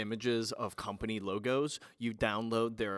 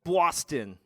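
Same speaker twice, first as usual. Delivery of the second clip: put-on Boston accent, louder and higher; 5 semitones higher, 6 dB louder, much slower than usual. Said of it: "Why'd you say it with a Boston accent?".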